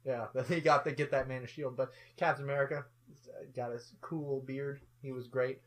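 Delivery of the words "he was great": ordinary talk, nothing marked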